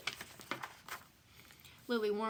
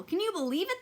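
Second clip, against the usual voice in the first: high, loud, amazed; delighted exclaiming; 9 semitones higher, 4 dB louder than usual. Acted. Parents marvelling at the baby's bubble, high and delighted. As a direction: gush over the baby. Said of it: "they exclaimed".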